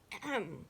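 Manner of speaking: small polite throat-clear; brief "ahem" before speaking up. A narrator's reading. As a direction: clear the throat to get attention. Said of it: "the chief frog spoke up".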